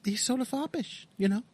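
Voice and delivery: a high, dainty caricature; foppish caricature. Pretend. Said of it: "He sort of gets high when he emphasizes things".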